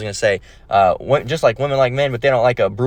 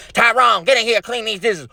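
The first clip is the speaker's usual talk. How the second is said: much higher, ordering voice; bossy girlfriend; 10 semitones higher than usual. Acted. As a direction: call him in like a nagging girlfriend. Girlfriend orders Tyrone inside.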